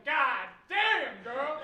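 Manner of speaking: loud, hammed-up catcall. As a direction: holler it at a passing girl. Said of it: "he's going".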